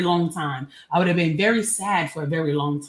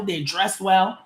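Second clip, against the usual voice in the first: ordinary delivery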